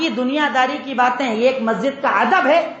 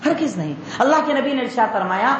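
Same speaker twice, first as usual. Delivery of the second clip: usual speaking voice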